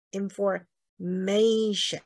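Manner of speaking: information very slowly, in-for-MAAAY-shun; pause, then hold a long MAY in information; penultimate stress. Shown as a demonstration. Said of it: "倒数第二音".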